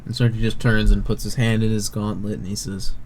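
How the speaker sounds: ordinary delivery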